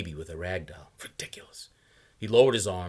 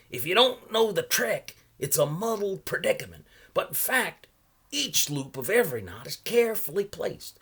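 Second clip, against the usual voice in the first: put-on a higher, louder voice for the odd old salesman; old man pitching his wares, higher and louder; 11 semitones higher, 5 dB louder than usual. A narrator's reading. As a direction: play the chatty old knot peddler. Salesman's voice in read story.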